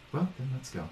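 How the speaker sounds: soft, secretive send-off; quietly rally the plotters; hushed conspirator. Acted. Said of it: "they are very much whispering".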